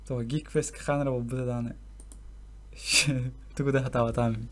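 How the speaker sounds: ordinary talk, nothing marked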